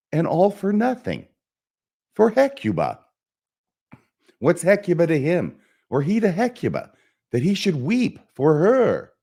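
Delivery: high-pitched, incredulous outburst; Hamlet. Acted. Hamlet marvelling that a player weeps for Hecuba, high and incredulous. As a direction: pitch leaps right up in theatrical disbelief. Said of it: "try and just perform the soliloquy here".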